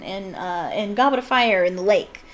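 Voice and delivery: usual speaking voice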